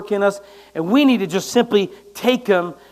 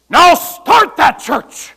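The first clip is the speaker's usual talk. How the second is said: slow, much higher, loud order; barked command; 7 semitones higher, 10 dB louder, much slower than usual. Retold or imitated. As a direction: order it like a drill sergeant. God commanding preacher, shouted.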